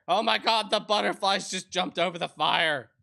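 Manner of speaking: loud excited outburst. Real, not acted. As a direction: yelp it out, thrilled. Startled by butterflies over fire.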